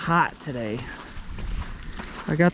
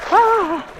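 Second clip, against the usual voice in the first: sharp cold gasp; 3 dB louder than usual. Real, not acted. Plunging into cold lake.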